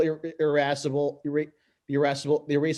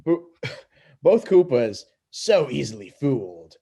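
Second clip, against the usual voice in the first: gloating with a laugh; 3 dB louder than usual. Acted.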